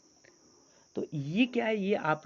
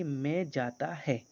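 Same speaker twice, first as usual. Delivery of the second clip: slow wrong sentence; 4 semitones lower, 6 dB quieter, slower than usual. Shown as a demonstration. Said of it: "क्या बोला है मैंने?".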